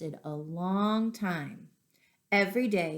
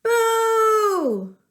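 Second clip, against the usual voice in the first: long, loud, high monster roar of boo; a big scary monster boo; 12 dB louder than usual. A narrator's reading. Lance out-scaring the teenager, loud monster boo.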